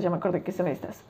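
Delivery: a quick hushed echo of the line just said; muttered to self. Real, not acted. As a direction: say it again quietly to yourself. Repeats it to herself under breath.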